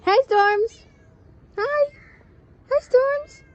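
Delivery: very high, loud cooing greeting; excited calling. Real, not acted. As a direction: squeal hello to a pet. Greeting cat from balcony.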